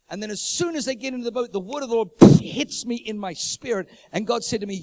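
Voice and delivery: high, loud, driving sermon delivery; fiery revival preacher; full-force preaching. Acted. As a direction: preach it out to the whole room.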